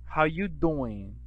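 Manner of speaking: slow, drawn-out Italian American How you doing; Italian American accent. Shown as a demonstration. Teacher shows the Italian American greeting, slowly. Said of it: "They say".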